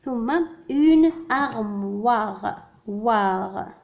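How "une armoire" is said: armoire pulled long; very slow. Shown as a demonstration. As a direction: stretch the word for listening. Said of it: "Donc c'est armoire".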